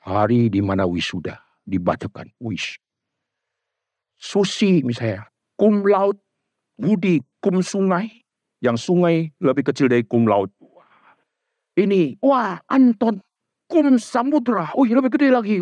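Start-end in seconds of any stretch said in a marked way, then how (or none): 4.2-15.6 high, hammed-up graduation announcer; preacher mocking a graduation roll call, high and showy. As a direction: announce the honours with mock grandeur.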